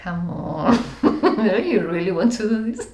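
fast ironic quip through laughter; mock-ironic come-on with a laugh. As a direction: tease them: really?. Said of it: "Spanish side of being a little bit ironic".

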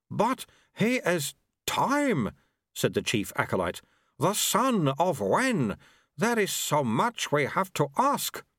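high, slow awestruck plea; awed plea. Acted. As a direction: beg for answers in wonder. Acolyte pleading in awe.

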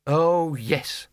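sarcastic agreement, falling away; sneering. A narrator's reading. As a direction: agree with a touch of scorn. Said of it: "sneered the Dean".

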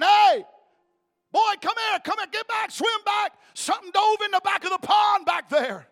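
high, loud frantic yell; mother screaming. Retold or imitated. Mother screaming at her son to swim back. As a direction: scream to call the boy back to shore. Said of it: "she's screaming at her son".

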